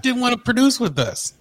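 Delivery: ordinary talk, nothing marked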